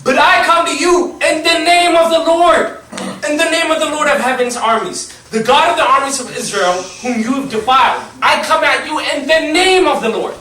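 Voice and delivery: loud, high, declaiming the verse; scripture proclamation. Acted. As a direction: proclaim it like a battle cry from the page.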